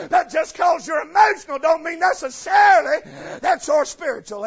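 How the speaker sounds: loud, high preaching, stretched on the key words; hammering preacher; full-force preaching. Acted. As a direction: preach it out hard to the congregation.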